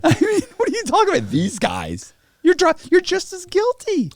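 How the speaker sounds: high, loud mock outrage; mock outraged agent. Mocking the FBI agent's outrage, high and loud. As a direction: play the incredulous agent, loudly.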